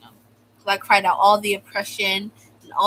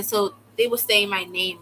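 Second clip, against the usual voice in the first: usual speaking voice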